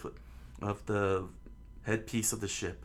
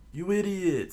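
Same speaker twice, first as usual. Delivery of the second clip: high, loud scolding idiot; 10 semitones higher, 6 dB louder than usual. Acted. Straw Hat Man scolds Luffy for the stab, loud and high. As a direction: snap it at the reckless kid. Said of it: "said the Straw Hat Man".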